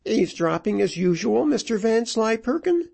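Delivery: high-pitched widow's voice, accusing; high, taunting question with a rising end; needling widow. A narrator's reading. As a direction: catch him out in a high voice. Widow needles the man who overheard.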